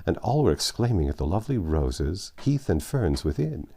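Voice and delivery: usual speaking voice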